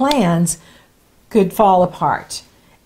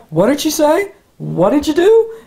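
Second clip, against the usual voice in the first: mock bafflement; 5 semitones higher than usual. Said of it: "others are like".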